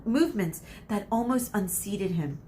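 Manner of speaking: ordinary delivery, nothing marked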